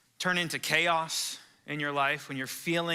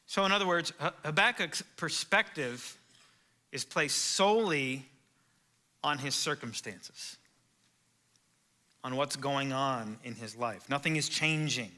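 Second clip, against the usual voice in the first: ordinary talk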